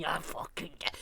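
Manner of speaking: whispered mock insult; hissed insult. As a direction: spit the last words under your breath. End of imagined threat.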